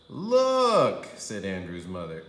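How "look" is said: high loud call of look; emphatic look. A narrator's reading. Mother making her point, emphatic high call. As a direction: grab their attention.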